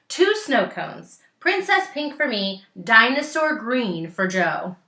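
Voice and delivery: eager order. Acted. Kids order snow cones eagerly.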